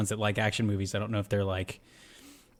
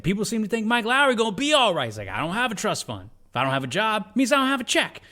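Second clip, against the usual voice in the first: high, loud ranting; impression of Martin Lawrence; 10 semitones higher, 5 dB louder than usual. Martin Lawrence ranting at his partner in the car, high and loud. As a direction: rant up high in a squeaky voice. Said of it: "Martin Lawrence is like".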